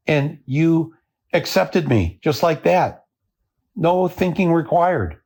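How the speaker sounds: high-pitched imitated scolding; chastising the viewers. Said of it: "chastises the viewers by saying".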